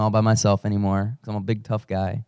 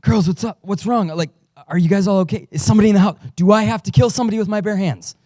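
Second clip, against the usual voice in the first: panicked dad; 6 semitones higher, 3 dB louder than usual. Retold or imitated. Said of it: "I'm panting, I'm breathing hard".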